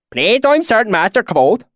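put-on high, loud rustic swineherd accent; thick valley accent. A narrator's reading. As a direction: speak up in broad backwoods dialect. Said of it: "his accent so thick and oily".

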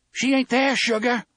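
high, loud Southern-tinged shout. A narrator's reading. Susanna shouts at Roland outside his mother's door, shouting. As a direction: shout it at someone who won't listen. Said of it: "Susanna shouts at him".

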